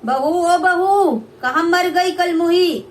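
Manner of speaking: calling out high and loud, slow on बहू; mother-in-law shouting at her daughter-in-law; shrill mother-in-law call. Acted. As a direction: yell across the house for the bride.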